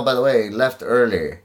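usual speaking voice

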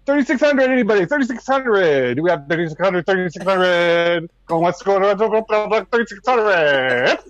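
calling out auction bids; auctioneer. Acted. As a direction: sell it like an auctioneer.

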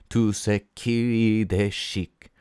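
slow, careful French pronunciation of tout ce qui est chic; French phrase. A narrator's reading. Narrator slips into French for tout ce qui est chic.

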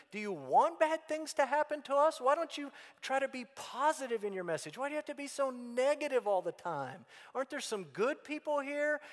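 high, whiny voice of an offended patriot; mocking objector. Preacher mimics critics scolding the prophet, high and whiny. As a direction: squeak out the indignant complaints. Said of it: "would step back and say".

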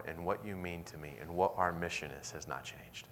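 hushed sermon. Acted. Preacher sharing Christ's forgiveness, soft and hushed. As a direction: draw the congregation close, almost whispering.

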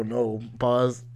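usual speaking voice

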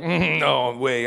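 slow, low, grudging no way; reluctant Jonah refusing. Retold or imitated. Preacher voicing Jonah refusing God's mission, low and slow. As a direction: drag out the refusal like sulky Jonah. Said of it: "And Jonah's like".

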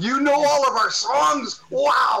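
high, slow and amazed, imitating a fan; awestruck fan. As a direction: gush like a stunned fan. Amazed band quoting English fans.

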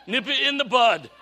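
loud, high, agitated catchphrase impression; hammed-up, insistent shout; Barney. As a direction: yell it like a worked-up TV deputy. Imitates Barney's famous agitated catchphrase.

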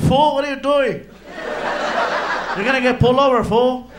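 loud scolding from the back seat; yelling. Retold or imitated. Felipe yelling at the driver from the back seat, loud and scolding. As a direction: yell at the reckless driver. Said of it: "Felipe's in the back seat yelling at me".

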